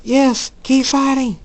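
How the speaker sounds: high, loud, slow urging; urgent shout. Acted. Girl urging her side on in battle, shouting. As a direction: cheer them on at full voice.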